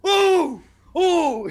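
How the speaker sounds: loud, high "ooh" of pain; imitating Dad's high yelps; mock pained cry. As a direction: yelp as if close to tears. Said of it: "He starts, like, almost crying".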